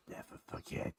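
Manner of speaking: barely audible, breath-only whisper. Shown as a demonstration. Girl after a drunken one-night stand, whispered. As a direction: whisper it as a haunting secret. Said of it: "when whispered to you".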